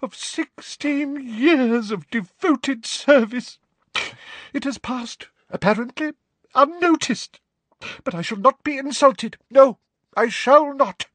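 wronged seer declaiming; wounded Trelawney. A narrator's reading. Trelawney protesting her service, high and wounded.

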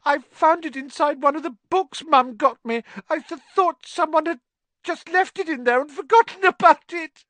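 put-on high, stammering young girl sobbing. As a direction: sob through the words. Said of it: "sobbed Ginny".

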